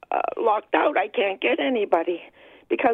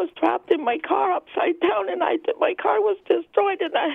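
very high, distressed voice; getting emotional; 10 semitones higher than usual. Real, not acted. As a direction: overcome recalling the crash, voice way up high. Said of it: "I get emotional when I think about it".

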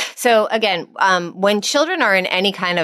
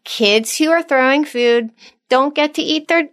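calm firm parent; 9 semitones higher than usual. Shown as a demonstration. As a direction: gently state the rule, no anger. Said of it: "You just say".

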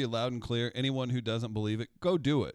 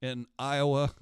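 mock prayer; 2 semitones higher, 4 dB louder than usual. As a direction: beg the Lord in a playful plea. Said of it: "I start praying".

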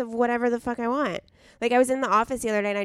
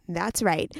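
normal talking voice

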